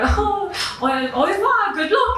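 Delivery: high sing-song French farewell; hint of a chirpy French shop assistant; French assistant's goodbye. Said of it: "she was like".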